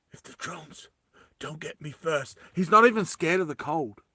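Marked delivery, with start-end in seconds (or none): 0.1-2.4 hushed, trailing-off aside; mock-fearful whisper. Stranded climber dreading the drones, mock-fearful whisper. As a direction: drop to a whisper, hammed-up.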